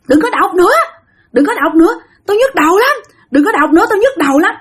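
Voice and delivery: high-pitched mock complaint; retold high, pained protest; imitated cursing yell. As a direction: act out an agitated man demanding silence. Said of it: "ba chửi nha".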